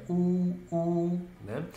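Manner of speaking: high, loud stretched "u"; train horn imitation. Shown as a demonstration. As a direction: hold both hoots long. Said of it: "igual o de cima". (buzina de trem, "u" agudo e esticado)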